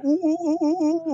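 high falsetto ooh ooh moans; mock a woman's high moaning; imitating a woman moaning. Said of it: "even Eddie Murphy said".

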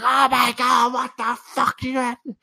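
high mock-furious mother breaking; exploding mom. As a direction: snap into a hammed-up furious mom. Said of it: "She's like".

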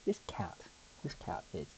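hushed whisper so the purr can be heard. Real, not acted. Whispering to viewers beside a purring cat, hushed. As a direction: breathe it out softly next to the cat.

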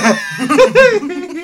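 high burst of laughter; real laughing fit; loud laugh. Real, not acted. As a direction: crack up loudly. Laughing at friend's joke.

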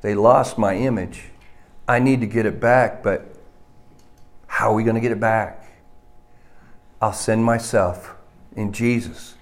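low, soft words spoken as God; hushed, low divine monologue. Acted. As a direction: voice God's thoughts quietly and low. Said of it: "In God's eyes, it's about".

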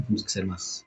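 quiet, flat, no expression at all; deadpan mumble. Shown as a demonstration. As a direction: mumble it with zero energy. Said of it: "tenemos que ser más expresivos". (murmullo inexpresivo)

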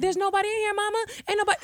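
high, pleading cover-up; high innocent denial. Retold or imitated. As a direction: deny it high and innocent to mama. Son lying to his mom.

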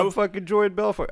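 brash high shout. Retold or imitated. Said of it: "he's like".

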